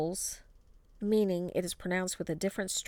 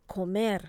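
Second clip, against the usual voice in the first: "Comer" with the weight on MER; stress on last syllable. Shown as a demonstration. Teacher models the rule for repeating, last-syllable stress. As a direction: punch the second syllable of "Comer". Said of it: "you must stress the last syllable".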